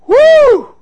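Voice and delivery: sudden high whoop of exclamation; high loud whoop. Real, not acted. Preacher whooping at the congregation mid-story, loud and high. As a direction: let out a loud woo.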